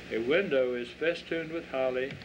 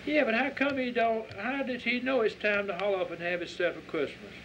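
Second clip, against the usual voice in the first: put-on curious child; 8 semitones higher than usual. Child Christine questions.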